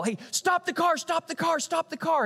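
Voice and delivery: high urgent mock yell, stop the car; retold yell. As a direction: call out sharply, imitating the wife in the car. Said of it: "my wife starts to kinda yell".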